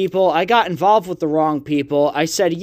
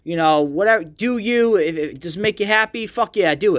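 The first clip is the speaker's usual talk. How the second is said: higher, hyped-up bro voice gushing over a truck; fake friendly bro; 4 semitones higher than usual. Mocking himself to a truck guy he secretly dislikes, hyped bro voice. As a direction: play the agreeable guy faking love for a truck. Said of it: "I would be cool if I'm like".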